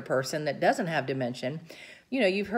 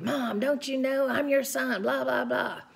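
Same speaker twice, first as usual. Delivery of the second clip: higher, pleading insistence; arguing son; 7 semitones higher than usual. Retold or imitated. Son arguing with his mother, higher and insistent. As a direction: push her to admit who you are. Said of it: "And he argued".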